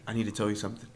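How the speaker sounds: low, hushed, solemn imitation; ominous stranger. Imitating the coworker confiding a secret, low and hushed. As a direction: drop the voice low and soft like a secret.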